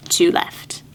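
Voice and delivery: breathy whispered aside, low. Real, not acted. Aside while counting monkeys.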